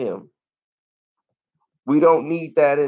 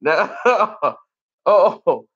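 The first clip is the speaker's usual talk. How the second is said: ordinary delivery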